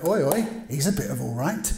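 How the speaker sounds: a light put-on voice of a leering wife, barely different from his own; lightly voiced cheeky woman eyeing Joseph. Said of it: "Potiphar's wife who's looking at Joseph going".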